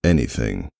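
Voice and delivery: plain reading voice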